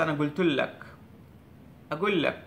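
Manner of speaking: আনা কুলতু লাক with কুলতু said as গুলতু; Saudi pronunciation. Retold or imitated. Teacher imitating how Saudis say the phrase, softened g. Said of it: "এরা সৌদিরা বলছে".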